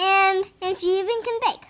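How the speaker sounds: ordinary delivery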